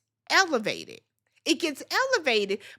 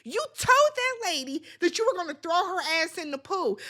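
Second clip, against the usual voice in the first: normal talking voice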